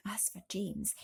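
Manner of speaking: usual speaking voice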